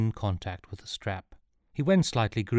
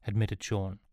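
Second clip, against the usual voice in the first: ordinary delivery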